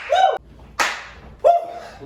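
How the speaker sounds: two high, loud "woo"s; high victory whoops; celebratory whoops. Real, not acted. Celebrating end of workout.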